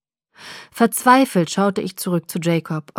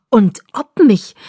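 forced out slowly, louder; blurted out; 2 semitones higher, 7 dB louder, slower than usual. A narrator's reading. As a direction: spit the words out, close to breaking. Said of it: "stieß er hervor".

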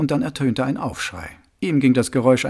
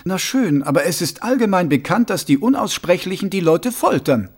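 gemurrt; 6 semitones higher, 4 dB louder than usual. Acted. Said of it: "murrte Sam".

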